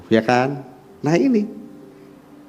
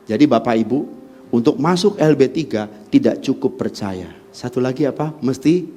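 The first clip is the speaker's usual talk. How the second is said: ordinary talk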